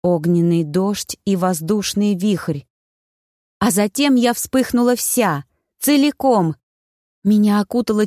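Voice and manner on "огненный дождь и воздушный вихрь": ordinary delivery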